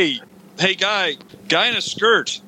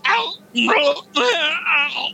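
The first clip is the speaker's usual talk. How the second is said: imitating Bobcat Goldthwait; 9 semitones higher, much slower than usual. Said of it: "And he's like".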